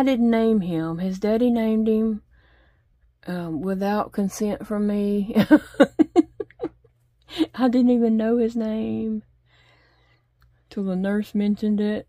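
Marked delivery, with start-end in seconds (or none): 5.4-6.7 a loud, high laugh at the memory; amused laugh. Real, not acted. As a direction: laugh out at the cheek of it. Laughs about husband naming son.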